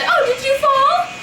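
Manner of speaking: high sweet fake concern; mock innocent. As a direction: play dumb, sugary and high. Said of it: "and I said".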